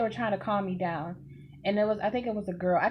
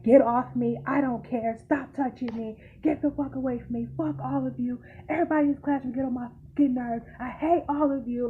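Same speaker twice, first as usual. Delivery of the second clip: higher, strained reenacted outburst; mock screaming; 4 semitones higher than usual. Student lashing out at the whole classroom, mock screaming. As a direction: act out a screaming fit without full volume. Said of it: "I'm screaming top of my lungs".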